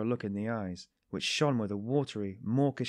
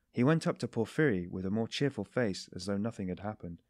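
ordinary delivery, nothing marked

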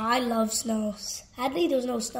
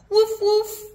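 high, loud yappy puppy barks; 10 semitones higher, 8 dB louder than usual. Acted. Reader barking as Biscuit the puppy, high and loud. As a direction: yip like a little puppy, much higher.

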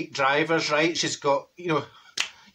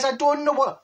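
higher-pitched attempt at a friend's accent; Neville's accent; 8 semitones higher than usual. Passenger thanking the driver, in put-on accent. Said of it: "I'm not doing his accent".

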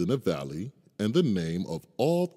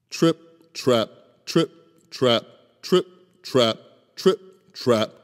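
heavy trip trap; 5 semitones higher, 5 dB louder than usual. A narrator's reading. Bridge sound under heavy goat.

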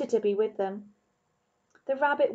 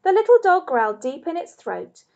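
plain reading voice

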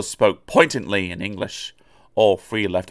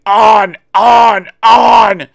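very high, very loud yell; runaway trucks yelling as they push; 12 semitones higher, 12 dB louder, much slower than usual. A narrator's reading. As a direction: yell it as the trucks surge forward.

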